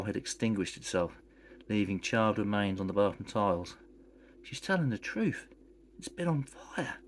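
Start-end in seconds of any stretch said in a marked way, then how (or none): 4.4-7.0 stunned. Acted. Patch stunned by burnt roll.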